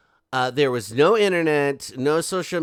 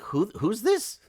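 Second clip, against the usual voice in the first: mock bafflement; 6 semitones higher than usual. Imagines a baffled man answering.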